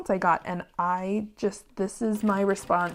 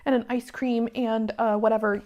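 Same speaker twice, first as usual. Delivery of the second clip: higher, gushing fawning apology voice; mock groveling apology; 5 semitones higher than usual. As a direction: grovel over the top to an angry customer. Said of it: "we kowtow to them and we say".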